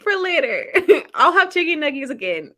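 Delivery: put-on amused retelling of a kid's line; laughing through a quote. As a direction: giggle through the kid's words. Quoting nephew while laughing.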